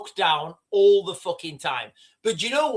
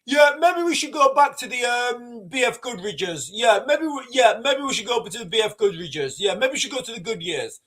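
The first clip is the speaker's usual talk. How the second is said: hopeful musing; 4 semitones higher than usual. Retold or imitated. Owner pondering tyres again, lightly mock hopeful. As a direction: talk yourself into new tyres, half-hopeful. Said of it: "you're like".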